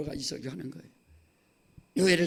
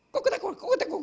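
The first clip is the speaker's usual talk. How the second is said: high squeaky chicken-like 꼬꼬대꼬; hammed-up hen cackle parody of tongues; mock clucking tongues; 14 semitones higher than usual. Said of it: "꼬꼬대빵은 아세요".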